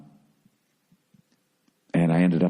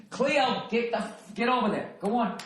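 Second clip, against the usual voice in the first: softer dog commands; 12 semitones higher, 4 dB quieter than usual. Real, not acted. Softly shooing the dog.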